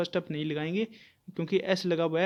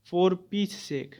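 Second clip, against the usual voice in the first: drawn-out example, word by word; slow dictation; much slower than usual. Shown as a demonstration. Teacher reading an example to students, very slowly. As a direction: spell the phrase out slowly for the class.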